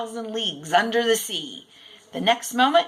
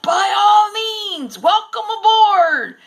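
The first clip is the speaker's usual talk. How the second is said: hearty shout; 12 semitones higher, 8 dB louder, slower than usual. A narrator's reading. Captain hailing over roaring wind.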